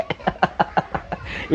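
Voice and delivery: soft breathy giggling run; tawa. Real, not acted. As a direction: laugh it out quietly. Laughing at own joke. (tertawa terkekeh)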